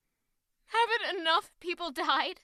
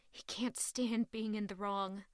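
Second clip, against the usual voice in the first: usual speaking voice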